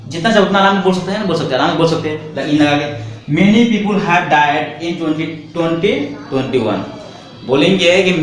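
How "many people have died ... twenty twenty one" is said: slow dictation. Shown as a demonstration. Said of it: "teacher dictates example sentence".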